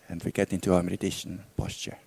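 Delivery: calm, unhurried meditation guidance with long pauses; guided meditation. Real, not acted. Teacher guides listeners back through a memory, calm meditation voice. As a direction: lead them inward gently, leaving silence between phrases.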